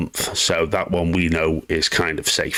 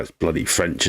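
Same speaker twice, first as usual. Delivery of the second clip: ordinary delivery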